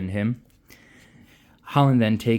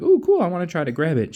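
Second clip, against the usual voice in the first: higher, quick, eager; greedy excitement; 9 semitones higher, 4 dB louder, faster than usual. Retold or imitated. Lila eyeing a vendor's magic item, high and eager. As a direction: rush it like a kid spotting treats.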